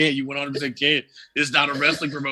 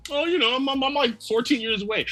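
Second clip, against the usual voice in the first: put-on higher, casual kid voice; mock Trunks; 9 semitones higher than usual. Trunks telling his age, higher casual voice. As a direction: answer breezily like a kid.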